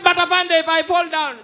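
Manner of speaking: high, loud panicked cry; panicked shout. Retold or imitated. Trapped man to the officer below, a high loud panicked shout. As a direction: plead down from the ledge.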